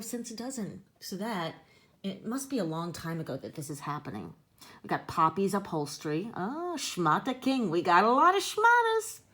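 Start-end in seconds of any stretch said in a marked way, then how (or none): 6.3-9.2 excited. Real, not acted. Excited about store sign.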